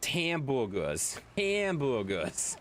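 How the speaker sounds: slow Boston accent, dropping the r in "hamburgers" to "hambugahs". Shown as a demonstration. Streamer sounding out a viewer's name, slow Boston accent. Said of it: "If you read it in a Boston accent".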